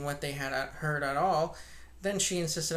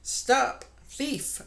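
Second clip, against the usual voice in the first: loud, high "Stop! Thief!"; shout; 9 semitones higher, 5 dB louder than usual. Acted. Shopkeeper shouting after the thief, loud and high. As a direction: yell after the fleeing thief. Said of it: "cried Huckster".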